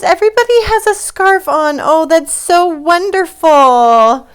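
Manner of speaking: thrilled to show off her friends; excited. Real, not acted. Host shows viewers her costumed stuffed animals, excited.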